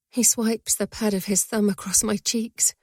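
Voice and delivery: ordinary delivery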